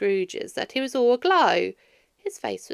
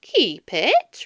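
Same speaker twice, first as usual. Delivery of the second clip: puzzled repeat; 2 semitones higher, 7 dB louder than usual. A narrator's reading. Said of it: "repeated Scrooge's nephew".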